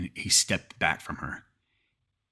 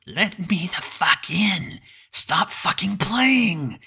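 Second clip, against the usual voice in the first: high, very slow, unnatural mimic voice; like a talking pet sounding out human words; halting, weirdly toned; 12 semitones higher, 5 dB louder, much slower than usual. A narrator's reading. Creature at the cabin door demanding entry, halting and weirdly toned. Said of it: "in this halting, weirdly toned voice".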